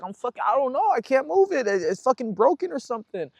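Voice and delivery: retold panic, high and strained; frantic, high, not knowing what's wrong; panicked. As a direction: son to mom, high and frantic. Panicking about his broken-feeling arm.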